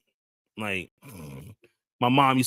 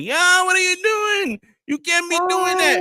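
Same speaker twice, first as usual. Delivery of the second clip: high, loud yelling impersonation; imitating the Indian worker; 5 dB louder than usual. Worker catching a thief in his booth, high mock shout. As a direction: yell it up high like the angry store worker. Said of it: "And he was like".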